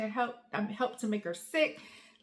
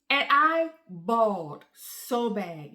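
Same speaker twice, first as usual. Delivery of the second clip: BAWLED and SO BAD drawn out very slowly; slow, weighty stress on "bawled"; emphatic; 4 dB louder, much slower than usual. Real, not acted. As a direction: stretch out "bawled so bad" word by word. Stressing how hard she cried.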